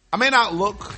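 usual speaking voice

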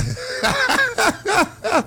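real high staccato laugh; amused high giggling; high-pitched laugh. Real, not acted. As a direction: burst into high, rapid laughter. Laughs at co-host's gummies joke.

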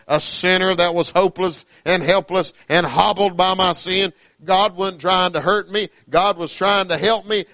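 fiery preacher climbing in pitch and volume; preaching at full force. Acted. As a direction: drive it home to the congregation. Preacher building to a climax.

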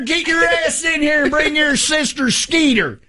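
high Southern holler; Southern accent. Acted. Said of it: "they all got Southern accents".

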